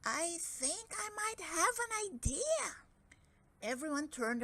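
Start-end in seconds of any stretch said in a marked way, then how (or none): 0.0-2.8 high, small timid voice; tiny piping voice. A narrator's reading. Shy caterpillar speaking up, tiny piping voice. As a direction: pipe up like a tiny creature. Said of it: "up piped a tiny voice".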